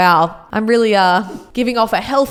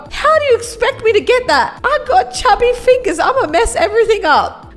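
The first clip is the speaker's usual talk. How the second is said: mock panic; 9 semitones higher than usual. Panicking over reaching M&M.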